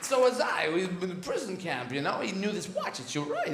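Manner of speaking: a hint of Walken's broken rhythm; halting Walken cadence, lightly put on; lightly voiced Christopher Walken monologue; bad Walken impression. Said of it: "This is Christopher Walken".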